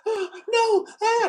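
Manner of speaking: very high, loud frightened wail; mock panicked cry. Someone hypnotized recalling the past, a high panicked cry. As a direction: cry out as if reliving a trauma. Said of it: "they're like".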